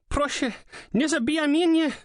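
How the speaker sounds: high, pleading scream in Polish; terrified scream. A narrator's reading. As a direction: beg for your life, screaming. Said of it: "the screaming started, high-pitched and painful".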